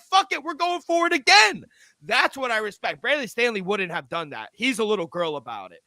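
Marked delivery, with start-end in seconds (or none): 0.0-1.6 hyped Dan Campbell. Retold or imitated. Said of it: "He said".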